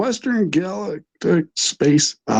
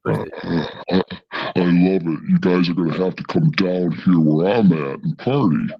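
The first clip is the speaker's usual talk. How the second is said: demon voice; 5 semitones lower than usual. Pretend. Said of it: "use my demon voice".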